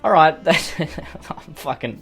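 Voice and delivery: normal talking voice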